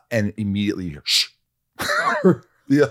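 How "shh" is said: sharp hushing "shh"; mimicked boyfriend's shush; imitated shush. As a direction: shush someone about to talk. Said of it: "Tom told her to shush".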